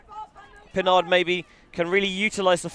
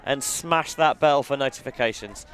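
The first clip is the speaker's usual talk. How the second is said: usual speaking voice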